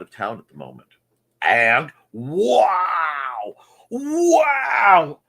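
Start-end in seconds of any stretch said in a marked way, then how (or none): 1.4-5.2 rising, louder, higher shouted wow; mock wow shout. Streamer mock-shocked at Foxy's absence. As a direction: ham up the shock.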